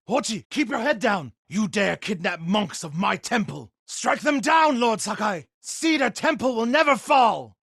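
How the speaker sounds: loud, high fighting yells; rallying war cry in the fight; battle shouts. Acted. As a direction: roar defiance at the enemy. Monk fighting Mongols, rallying.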